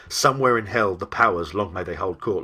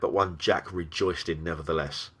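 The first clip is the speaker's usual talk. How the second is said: ordinary delivery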